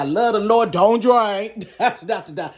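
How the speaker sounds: loud preachy mockery; mock preaching. Comic mocking churchgoers who preach no drinking.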